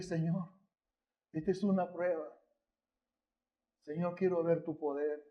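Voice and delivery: soft, low whispered plea to God; hushed prayer. Retold or imitated. Husband praying to God at hospital, hushed and soft. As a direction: pray under your breath, pleading.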